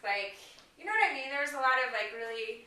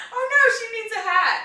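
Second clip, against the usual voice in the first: lightly voiced stranger, higher and louder; imitating the guy; 11 semitones higher, 11 dB louder than usual. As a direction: play the man insisting, hammed-up. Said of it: "And he's like".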